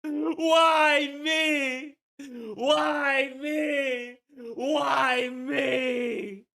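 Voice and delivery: high, loud drawn-out cry of despair; over-the-top anguished shouting; mock despairing wail. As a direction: wail it melodramatically to the heavens. Streamer loses his mouse, wails.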